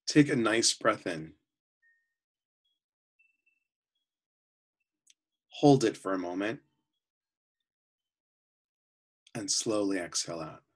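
calm, unhurried voice with long pauses between phrases; guided meditation. Real, not acted. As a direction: guide them gently into relaxation, often softening at phrase ends.

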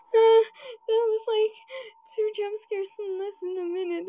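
high, loud burst of shock after a scare; real jolted disbelief; shaken outburst. Real, not acted. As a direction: blurt it out still rattled. Just hit by jump scares.

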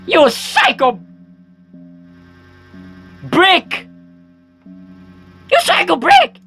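high, loud screechy threat; hammed-up frantic rage; angry character voice. Voicing enraged character, frantic play.

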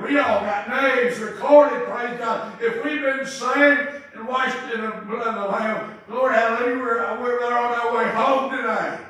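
loud hallelujah preaching; full-force preaching. Real, not acted. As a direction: shout the promise to the church.

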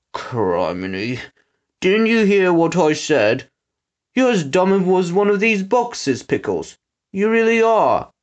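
fed-up henchman, pitch swooping; exasperated muttering. A narrator's reading. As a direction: scold your dim partner in exasperation. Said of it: "Trout muttered".

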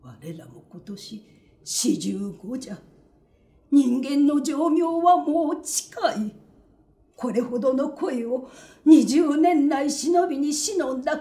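earnest aging lover pouring out his heart; lovesick middle-aged man. Acted. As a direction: confess a long-hidden love, rising passion. Tojuro confesses his love.

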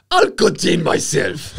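high, drawn-out mock Zimbabwean accent. Co-host breaking his promise to drop the voice, mock Zimbabwean accent. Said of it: "you're not gonna do the voice".

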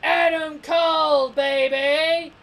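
loud, high, drawn-out mock catchphrase; wrestler catchphrase. Mocking Adam Cole's catchphrase to viewers, loud and hammed-up. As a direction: ham up a heel's cocky entrance call. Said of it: "He always kept doing the".

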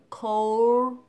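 "cold" loud and drawn out into a dark L. Shown as a demonstration. Teacher demonstrating the L, "cold" loud and stretched. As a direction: stretch "cold", tongue curling up for the L. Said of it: "Hất lưỡi lên làm âm L".

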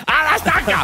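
high loud mock mob shout; mob cry. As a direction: yell it like a torch-waving mob.

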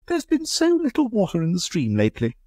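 high, light society lady asking; Amanda's voice. Acted. Amanda objects.

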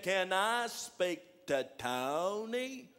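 very slow, drawn-out caller; slow-talking John on the phone; John's slow talk. Retold or imitated. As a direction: stretch every word like a slow talker. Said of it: "John talks like that".

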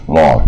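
"log" with tongue curled and an open vowel; slow, open "log" as a teacher's model. Shown as a demonstration. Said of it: "就是卷舌log".

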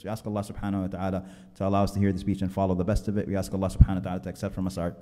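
fast, running supplication on a low, level pitch; congregational prayer. Real, not acted. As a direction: lead a rapid closing du'a, steadily. Leads closing supplication.

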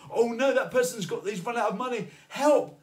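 high, loud, mock panic; hammed-up alarm, rising to a cry of help; freaking out. God pictured panicking over a person, mock freak-out. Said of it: "freaking out saying".

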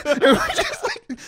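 high, loud, slow laughing; laughing hard while telling. Real, not acted. Friend laughing while telling teacher story.

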